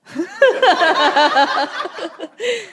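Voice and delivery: high, loud burst of laughter. Real, not acted. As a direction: laugh out loud at your own joke. Laughs after podcast joke.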